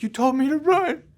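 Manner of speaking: put-on a high, loud upset protest from a young teen; choked kid pleading; near tears. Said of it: "I'm almost in tears".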